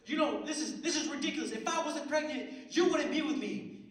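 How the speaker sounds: high, loud mock tirade; angry girlfriend. Pregnant girlfriend scolding her drunk boyfriend, high hammed-up anger. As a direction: imitate a fed-up girlfriend building to a shout.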